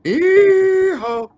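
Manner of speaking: a high, loud, hammed-up cowboy whoop; mock cowboy yee-haw. Cohost jokes about women and pills, hammed-up yee-haw. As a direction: holler it like a rodeo cowboy. Whooping after cat treat joke.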